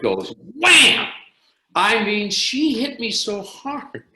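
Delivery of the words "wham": loud, high "Wham!" slap noise; shouted slap sound effect; mimic slap sound. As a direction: smack the word out like a blow.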